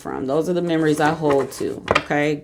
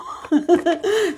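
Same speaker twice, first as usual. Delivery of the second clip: burst of laughter; 13 semitones higher than usual. Real, not acted. Laughing at own friend story.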